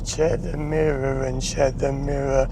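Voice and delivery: slow, drawn-out instruction; slow talking. Shown as a demonstration. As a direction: say it slowly and deliberately. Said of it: "if I talk like this".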